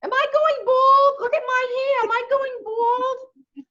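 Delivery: very high, loud, whimpering worry; whiny worried Yankee. Retold or imitated. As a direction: fret about going bald, half crying. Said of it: "look at me and say".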